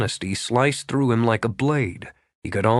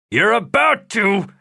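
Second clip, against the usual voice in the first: louder, clipped-off menace; gnawed-off threat; 10 semitones higher, 6 dB louder than usual. A narrator's reading. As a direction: bite each word off at him. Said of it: "He gnawed off the words".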